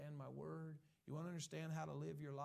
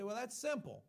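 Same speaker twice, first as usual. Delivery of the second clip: loud, higher mock shrug; dismissive listener; 7 semitones higher, 8 dB louder than usual. Preacher imitating a churchgoer, loud and dismissive. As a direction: brush it off as too easy.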